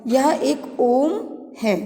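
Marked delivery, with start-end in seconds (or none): 0.8-1.1 ओम with a slow, drawn-out vowel; prolonged vowel. Shown as a demonstration. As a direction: stretch the ओ in ओम well beyond a normal long vowel. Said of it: "ह्रस्व स्वर से तीन गुना ज़्यादा".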